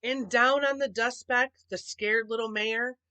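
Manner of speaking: ordinary delivery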